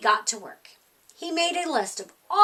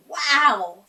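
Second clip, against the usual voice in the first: louder gasp of wonder; amazed wow; 3 semitones higher, 7 dB louder than usual. A narrator's reading. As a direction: marvel at the sight. Amazed at the snow.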